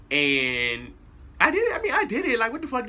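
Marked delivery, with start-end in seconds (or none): 1.4-2.9 high, loud blurted admission; excited outburst. Real, not acted. Confessing to viewers, high and loud. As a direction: burst out with it, much higher and louder.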